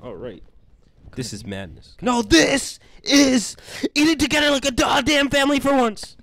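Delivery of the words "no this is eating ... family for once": high, loud hammed-up battle cry; mock-heroic bellow at full pitch; Sparta-style yell. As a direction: shout it like a warrior king. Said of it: "you have to, like, almost yell it".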